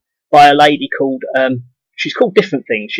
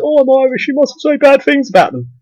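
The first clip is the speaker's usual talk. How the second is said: much higher whiny mock voice; mocking idiot; 13 semitones higher than usual. Vlogger mocking defensive Irish idiots, high whiny mimicry. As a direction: sneer it in a silly high voice. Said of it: "idiots who says".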